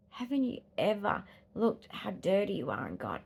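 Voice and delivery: ordinary talk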